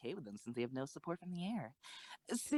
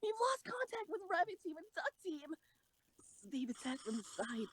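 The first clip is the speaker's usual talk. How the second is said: much higher urgent report; alarmed report. Acted. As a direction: report the losses anxiously. Tense battle report.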